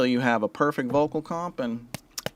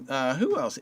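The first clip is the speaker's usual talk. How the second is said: ordinary talk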